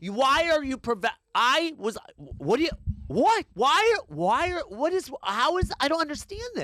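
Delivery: can't believe the service; flustered exasperation. Real, not acted. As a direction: sputter in disbelief.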